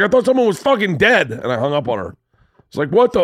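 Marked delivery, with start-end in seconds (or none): 0.0-1.3 loud, high-pitched angry outburst; pissed off. Retold or imitated. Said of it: "I was so fucking pissed". 2.9-3.2 loud, high-pitched angry outburst; pissed off. Retold or imitated. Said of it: "I was so fucking pissed".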